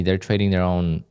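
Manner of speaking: normal talking voice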